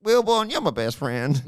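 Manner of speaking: high mock friendly voice; lightly hammed-up impression of a friend; imitating Sid. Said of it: "he'd also send them, say something like".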